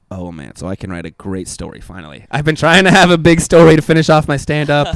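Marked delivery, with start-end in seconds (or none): 0.1-2.2 gleeful muttered aside. Retold or imitated. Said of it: "I was immediately like".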